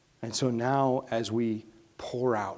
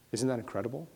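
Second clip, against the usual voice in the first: usual speaking voice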